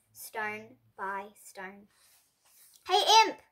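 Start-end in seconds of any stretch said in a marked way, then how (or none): 2.8-3.4 loud, high friendly call. A narrator's reading. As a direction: call after the girl running off. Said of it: "Florrie called".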